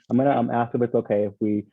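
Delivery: usual speaking voice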